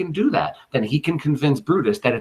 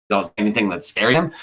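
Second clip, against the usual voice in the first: ordinary talk, nothing marked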